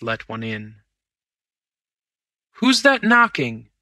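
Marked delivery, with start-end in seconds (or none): 2.6-3.7 high, loud call from upstairs; Ewbank calling down. A narrator's reading. Bank manager calling down to the knocker, high and loud. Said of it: "said Ewbank up above".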